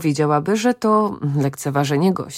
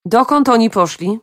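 higher, louder call from another room; raised calling voice; 5 semitones higher, 5 dB louder than usual. A narrator's reading. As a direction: shout the question through the house. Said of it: "Majzi skrzyknęła ze swego pokoju".

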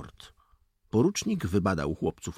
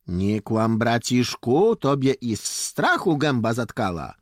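raised voice, needling a friend; teasing retort; 4 semitones higher, 6 dB louder than usual. Acted. Boy accuses his friend of fear, teasing. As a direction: taunt him loudly for being scared.

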